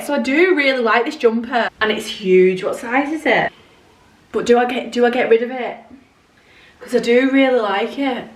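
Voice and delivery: usual speaking voice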